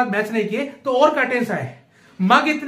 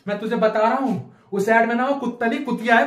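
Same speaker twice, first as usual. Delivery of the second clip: ordinary talk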